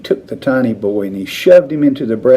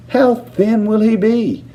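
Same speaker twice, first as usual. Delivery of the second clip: anxious; 11 semitones higher than usual. A narrator's reading. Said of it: "asked Missus Teavee anxiously".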